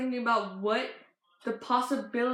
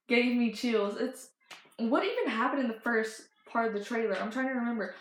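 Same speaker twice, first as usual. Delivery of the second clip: ordinary talk, nothing marked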